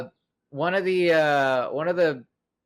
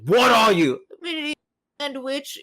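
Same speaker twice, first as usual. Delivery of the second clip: mock yelling chef; 7 semitones higher, 3 dB louder than usual. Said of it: "he's just like".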